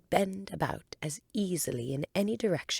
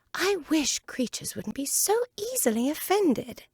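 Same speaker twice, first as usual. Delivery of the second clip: put-on higher girl's voice, muttered thought; grumbling to herself; Alice's higher voice; young Alice; 11 semitones higher, 3 dB louder than usual. Alice thinking.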